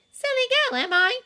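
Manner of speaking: high, loud mock sneer; mocking Rita. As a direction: throw the insult back. Hermione mimicking Rita.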